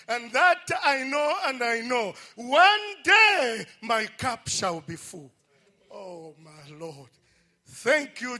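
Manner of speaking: rising church preaching with shouted praise; fervent preaching. Real, not acted. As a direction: preach it out to the devil, building to a cry. Testimony turns into fervent preaching.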